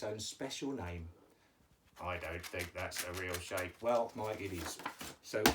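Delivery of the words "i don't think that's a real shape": low, monotone puppet; puppet Mike's voice. Pretend. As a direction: speak low and soft on one level note. Teacher voices puppet Mike.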